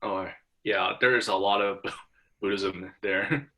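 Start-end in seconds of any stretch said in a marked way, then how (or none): none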